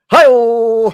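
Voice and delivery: loud, high comic exclamation; hammed-up "hi-oh". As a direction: sell the punchline like a talk-show sidekick. Punchline after dirty joke.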